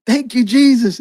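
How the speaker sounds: high, overjoyed retold cry; praising God. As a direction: imitate a woman amazed at healing. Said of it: "she starts praising God".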